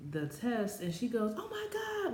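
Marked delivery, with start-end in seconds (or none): 1.4-2.1 very high imitated squeal; super excited. Sister seeing the positive test, super excited. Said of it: "she's super excited".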